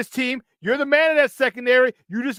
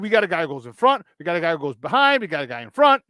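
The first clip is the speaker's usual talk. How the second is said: imitating the coach; 7 semitones lower than usual. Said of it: "he said".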